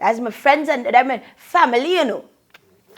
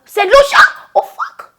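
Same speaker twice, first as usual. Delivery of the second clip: higher and louder, sudden burst of excitement; excited exclamation; 11 semitones higher, 8 dB louder than usual. Real, not acted. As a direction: blurt it out as it hits you. Suddenly remembers Saint Lucia.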